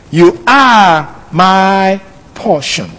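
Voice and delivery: slow, drawn-out reading of a verse; a measured, reverent declaration. Shown as a demonstration. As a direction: savour each word slowly. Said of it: "that's how he acknowledged that relation".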